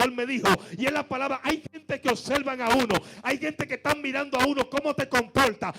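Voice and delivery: loud, rising fervent preaching; preaching at full force. Acted. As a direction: build it into a rousing sermon climax.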